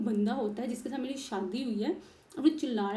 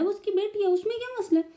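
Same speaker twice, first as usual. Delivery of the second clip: much higher, louder arguing back; heated retort; 7 semitones higher, 7 dB louder than usual. Retold or imitated. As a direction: push back hard in disbelief. बहस में खुद का जवाब. (बहुत ऊँची आवाज़ में बहस करते हुए जवाब)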